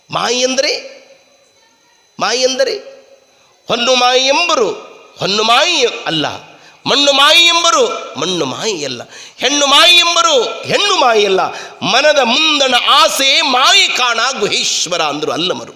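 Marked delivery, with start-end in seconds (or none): 3.7-15.0 emphatic declaimed verse, phrase by phrase; verse recitation. Acted. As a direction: build the recital to a ringing peak.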